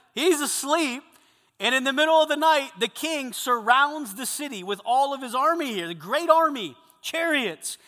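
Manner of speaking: loud, high, driving sermon delivery; preaching at full force. Acted. Preacher telling the men the Elisha story, loud and driving. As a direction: proclaim the story with mounting force.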